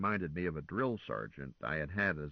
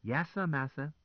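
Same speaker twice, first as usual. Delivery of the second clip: mock servile; 7 semitones higher than usual. A narrator's reading. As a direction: play the cringing servant in jest.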